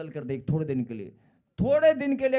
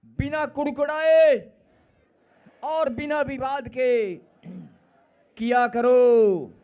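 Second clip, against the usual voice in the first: loud slow recitation; 4 semitones higher, 8 dB louder, slower than usual. Shown as a demonstration. Preacher leading congregation in verse, slow shouted proclamation. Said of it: "फिर से बोलो".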